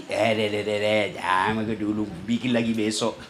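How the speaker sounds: low, soft tempting voice of the devil; devil whispering. Acted. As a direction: coax quietly like a sly devil. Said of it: "itu setan bisik ki".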